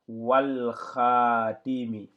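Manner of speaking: wal khatimi drawn out slowly; slow, loud Arabic reading. Shown as a demonstration. Teacher reading the phrase slowly for students. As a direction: stretch every sound of wal khatimi.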